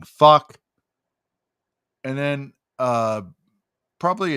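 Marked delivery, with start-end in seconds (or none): 0.0-0.6 loud barked curse from a stranger in the crowd; retold yell. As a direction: belt it out like a fan yelling in the lobby. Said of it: "Somebody's yelling".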